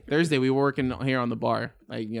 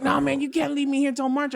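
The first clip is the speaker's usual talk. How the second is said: whiny friend; 11 semitones higher, 3 dB louder than usual. Retold or imitated. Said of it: "He's like".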